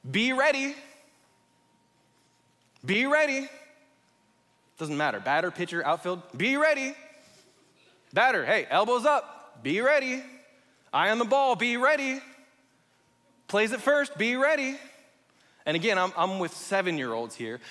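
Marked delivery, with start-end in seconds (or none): 0.1-3.5 loud high call of be ready; parent calling from bleachers. Retold or imitated. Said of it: "And it's this". 6.4-14.8 loud, high coaching calls; bleacher parent shouting. Retold or imitated. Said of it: "I hear this phrase over and over".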